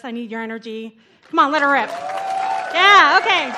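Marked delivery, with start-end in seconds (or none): 1.3-3.5 loud, pumped call to the audience; hyping the crowd. Real, not acted. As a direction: rev up the room.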